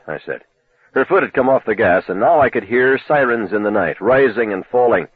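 ordinary delivery

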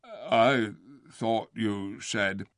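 very slow, dull bear; slow, puzzled. Acted. Bear questioning the ape, very slow. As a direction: drag each word like a slow bear. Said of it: "slow, puzzled voice".

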